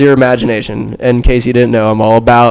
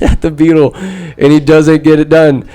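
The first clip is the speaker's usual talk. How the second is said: ordinary delivery, nothing marked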